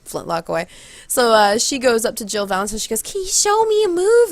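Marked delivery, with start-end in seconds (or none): 3.0-4.3 put-on high, pleading girl voice; eager girl imitation. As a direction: ask like an eager fan, high-pitched. Retold eager girl asking.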